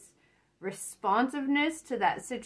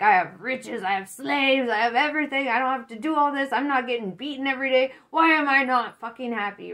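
louder, higher rising rant, speeding up; frustrated master; 4 semitones higher, 6 dB louder than usual. Retold or imitated. Master ranting at his happy slave, loud frustrated outburst. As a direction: rant like a rich man who can't be happy. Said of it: "He's like".

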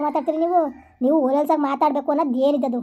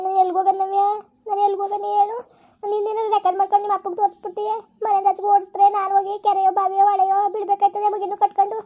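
higher, rising stepmother wail of self-pity; lamenting stepmother; 5 semitones higher than usual. Acted. Stepmother to stepdaughter over the recording, higher self-pitying lament. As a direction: cry out where she could possibly go, half-wailing.

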